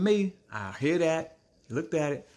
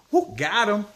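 high laugh breaking into a teasing question; laughing hard, then a teasing call-out; 9 semitones higher, 5 dB louder, slower than usual. Real, not acted. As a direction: crack up at the memory, then tease. Laughing at own story.

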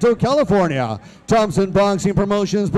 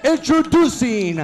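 lucha libre announcer; 3 semitones higher than usual. Acted. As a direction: stretch the names like a ring announcer. Ring announcer introducing fighter.

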